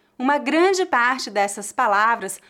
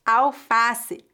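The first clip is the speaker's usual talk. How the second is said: normal talking voice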